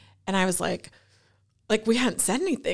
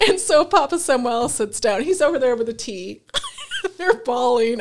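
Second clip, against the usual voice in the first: laughing; 6 semitones higher, 5 dB louder than usual. Real, not acted. Laughing while recounting the scene.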